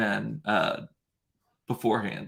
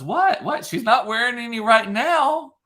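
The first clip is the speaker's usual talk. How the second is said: put-on high innocent child voice, louder; imitating the little girl; 10 semitones higher, 4 dB louder than usual. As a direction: guileless kid blurting it out. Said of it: "the little girl goes".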